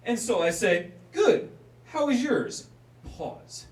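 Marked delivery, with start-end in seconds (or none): 1.1-2.7 forced polite. Retold or imitated.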